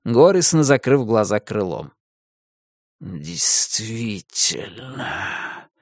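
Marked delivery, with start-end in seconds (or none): 3.0-5.7 soft, very slow, rustling whisper; sinister whisper. Acted. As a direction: rustle it out menacingly. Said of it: "прошелестел тихий зловещий шёпот".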